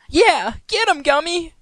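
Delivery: very high, loud cartoon pony cheer; squeaky pony shouting encouragement; Pinkie Pie cheering. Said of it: "prompting the mini horse to cheer".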